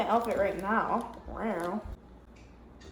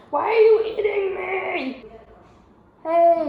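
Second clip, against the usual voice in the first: loud, high protest at the cat; loud, higher "why are you eating me"; mock indignant; 7 semitones higher, 8 dB louder than usual. Real, not acted. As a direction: complain to the biting cat. Cat bites her.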